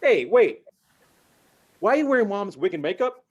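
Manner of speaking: high, loud shocked yelling across the room. Retold or imitated. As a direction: yell in disbelief. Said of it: "you hear me yelling".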